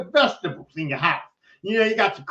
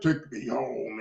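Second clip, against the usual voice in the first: low, quiet mock-hero plea; imitating wounded Superman; 9 semitones lower, 8 dB quieter, much slower than usual. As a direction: drop low and soft, imitating an injured hero. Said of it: "And Superman's like".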